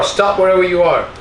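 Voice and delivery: ordinary talk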